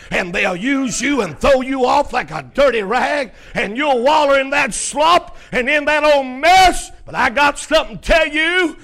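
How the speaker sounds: high, loud, driving preacher's shout; full-force preaching. Real, not acted. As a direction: build from urgent warning to shouted exhortation. Preacher building to full-force exhortation.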